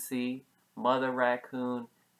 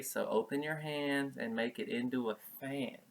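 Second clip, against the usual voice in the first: ordinary delivery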